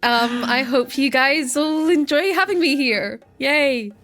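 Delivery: high, giddy excitement; nervously thrilled to be introduced; excited, mildly terrified. Real, not acted. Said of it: "but excited".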